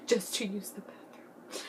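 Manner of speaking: hushed dad. Retold or imitated. Dad questioning in church.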